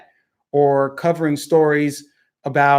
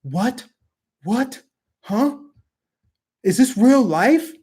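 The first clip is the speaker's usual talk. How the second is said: higher, baffled mock anchor; mocking Don Lemon; 6 semitones higher, slower than usual. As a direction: parody a stunned newsman. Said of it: "Like Don Lemon the other night".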